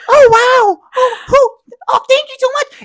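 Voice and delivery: very high, loud squeaky thank-you voice; fake polite gratitude. Thanking silent party guests, shrill fake gratitude. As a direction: overdo the sweet thanks like a forced performance. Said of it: "you're hearing, like".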